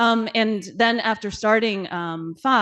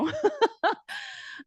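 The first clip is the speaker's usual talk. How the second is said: a sudden high laugh that fades out; high burst of laughter. Real, not acted. Laughing at her own remark.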